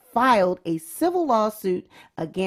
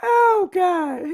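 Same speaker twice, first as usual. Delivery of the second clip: 1 laughing; 9 semitones higher, much slower than usual. Real, not acted. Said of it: "laughing fit".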